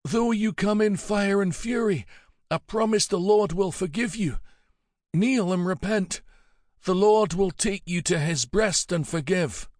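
put-on high, pleading old priest voice; old abbot forgiving. Dying abbot urging Helgi to repent, high and pleading. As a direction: beg the killer to repent.

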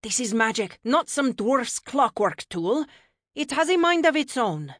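high, loud, indignant correction; cross. A narrator's reading. Goddess defending her magic to her godson, cross. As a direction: set them straight crossly, pitch climbing. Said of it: "Freya ordered crossly".

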